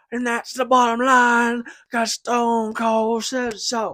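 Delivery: high, loud wrestler promo voice; mock Stone Cold catchphrase; Stone Cold impression.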